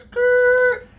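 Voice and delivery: high imitated skid; tire screech. Imitating the car swerving onto the curb. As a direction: squeal it like tires on a curb.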